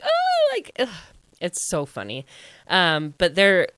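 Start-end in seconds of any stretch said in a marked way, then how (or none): 0.0-0.9 very high excited "Oh"; giddy squeal. Retold or imitated. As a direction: squeal in delight. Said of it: "they're like".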